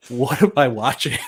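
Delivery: laughing. Retold or imitated. Retold own reaction.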